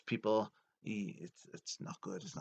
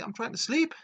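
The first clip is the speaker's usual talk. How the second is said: high-pitched mock outrage; indignant protest; 14 semitones higher, 4 dB louder than usual. Boss woken by strangers in his bedroom, high indignant protest. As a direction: complain in a high, put-upon voice.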